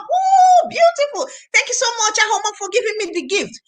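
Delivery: high, thrilled and grateful; delighted thanks. Pretend. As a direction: jump with joy at the gift. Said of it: "Mary will jump".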